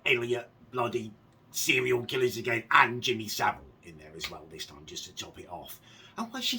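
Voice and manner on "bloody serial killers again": normal talking voice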